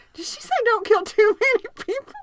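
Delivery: teacher asking her co-teacher in high, loud disbelief; astonished. Retold or imitated. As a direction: ask in stunned disbelief. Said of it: "I looked at the other teacher and I said".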